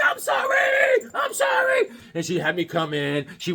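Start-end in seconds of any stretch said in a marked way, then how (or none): 0.0-1.9 slow, very high whiny apology; high pleading apology. Retold or imitated. Boy apologizing to his mom, high slow whine. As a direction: beg like a caught little kid. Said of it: "I was like".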